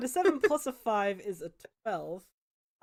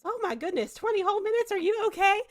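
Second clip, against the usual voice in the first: much higher, gushing concern; fussy sympathetic villager; 11 semitones higher, 3 dB louder than usual. Acted. The pious villager fusses over the freed knight, high and doting.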